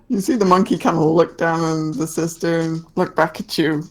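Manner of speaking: ordinary talk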